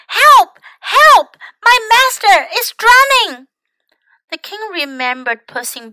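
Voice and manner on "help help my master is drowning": high, loud cry for help; shouting for help. A narrator's reading. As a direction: yell in alarm, high and loud. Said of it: "waving his arms and crying".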